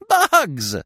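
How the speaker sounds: high, gleeful bird voice; amused woodpecker. A narrator's reading. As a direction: pitch it way up, as if amused. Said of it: "said the woodpecker with a laugh".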